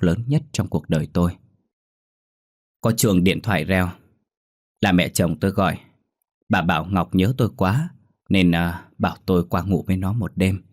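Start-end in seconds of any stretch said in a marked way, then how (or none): none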